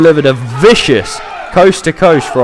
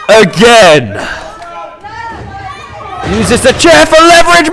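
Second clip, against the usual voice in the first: wrestling commentator; 14 semitones higher, 4 dB louder, slower than usual. Acted. Live wrestling play-by-play.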